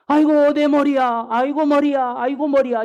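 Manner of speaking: imitating a child crying out in pain. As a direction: whine it like a sick little boy. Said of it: "이렇게 얘기하는 거예요".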